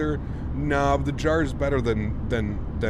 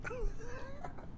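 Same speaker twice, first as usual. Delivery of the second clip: high soft laugh; 15 dB quieter than usual. Real, not acted. Dog takes treat oddly.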